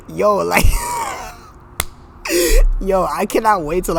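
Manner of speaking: loud shout through laughter; cracking up at her own rant, shouting; laughing shout. Real, not acted. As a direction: yell it while cracking up.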